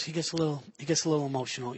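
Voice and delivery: hushed excuse for a friend; quiet aside. Retold or imitated. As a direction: murmur an apology to the waiter. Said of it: "Bill just looks at him and goes".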